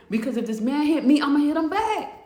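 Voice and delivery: emphatic, worked-up inner plea; building defiance. Retold or imitated. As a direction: act out a heated vow to fight back. Inmate's desperate inner vow building.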